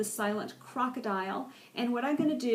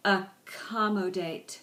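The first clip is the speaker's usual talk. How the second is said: "Accommodate" drawn out slowly, syllable by syllable; slowed-down pronunciation; slower than usual. Shown as a demonstration. Teacher modelling "Accommodate" for the class, slowed down by syllable. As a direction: stretch each syllable of "Accommodate". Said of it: "with varying degrees of speed".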